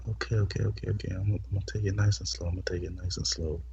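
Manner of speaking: soft, fast reassurance; hushed reassurance. Retold or imitated. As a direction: murmur it quickly. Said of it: "I'm like".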